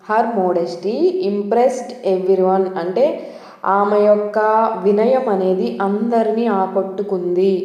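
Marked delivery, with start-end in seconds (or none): 0.1-2.6 very slow, word by word; slow example sentence. Shown as a demonstration. Teacher reads the example sentence very slowly to learners. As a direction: read it slowly for the class. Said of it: "second example".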